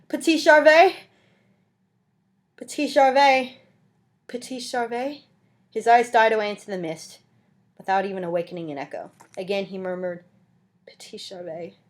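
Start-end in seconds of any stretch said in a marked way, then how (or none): 0.1-5.2 loud, high calls that fade towards the end; calling out. A narrator's reading. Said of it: "called out once more". 10.8-11.8 much softer, barely voiced murmur; feeble murmur. A narrator's reading. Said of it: "with a feeble and almost inarticulate voice".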